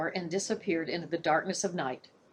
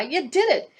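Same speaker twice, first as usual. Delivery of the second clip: much higher, louder, joyful cry; overjoyed; 9 semitones higher, 5 dB louder than usual. Acted. Boy cries out in joy at his raven. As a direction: burst out in delight.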